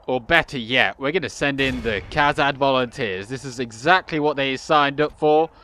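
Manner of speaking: ordinary talk, nothing marked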